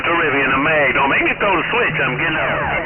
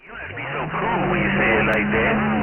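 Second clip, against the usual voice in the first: ordinary talk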